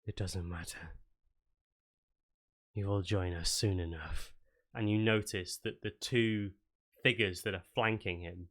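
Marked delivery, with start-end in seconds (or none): 0.1-4.3 low, cold and distant, like something not quite alive; a lightly voiced undead herald, calm and sure; hint of a creepy cult envoy; ominous stranger voice.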